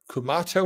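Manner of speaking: Kumato said with an "ah", like "koo-MAH-to"; first pronunciation option. Shown as a demonstration. Asking the group how to pronounce it, "ah" version of Kumato. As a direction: say Kumato with a broad "ah" in the middle. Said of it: "How do you pronounce that?".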